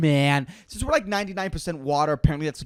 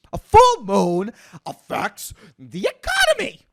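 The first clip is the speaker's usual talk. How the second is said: "full moon" and "economy" drawn out high and loud; slow, loud, word-by-word punch through "economy"; emphatic proclamation; 5 semitones higher, 5 dB louder than usual. Acted. Hammers his absurd claim after stating it.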